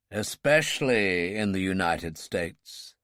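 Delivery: slow, low and dark; darkly. Acted. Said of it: "the President said darkly".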